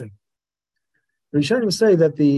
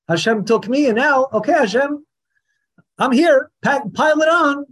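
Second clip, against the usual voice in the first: mock entitled; 8 semitones higher, 3 dB louder than usual. Mimics entitled attitude.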